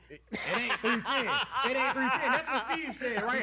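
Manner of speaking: laughing loudly through "It ain't three ten". Real, not acted. Co-host teasing about the salary figure, laughing out loud.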